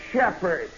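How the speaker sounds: "Shepard" spoken crisp and separate, falling at the end; a sergeant singling out "Shepard" with slow clear care; said distinctly. Retold or imitated. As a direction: lay out each part of "Shepard" clearly. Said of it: "he would say distinctly".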